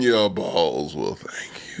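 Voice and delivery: low, soft and very slow, after a savoring mm; hammed-up slow, hushed sign-off; extra-drawn-out slogan. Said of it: "put some extra on that".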